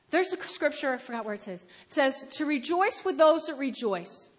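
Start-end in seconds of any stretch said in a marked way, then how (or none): none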